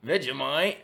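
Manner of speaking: "Vegemite" in a put-on Australian accent; Aussie accent. Shown as a demonstration. YouTuber showing the few Aussie words he can do, Australian accent. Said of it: "I can say certain words in an Aussie voice".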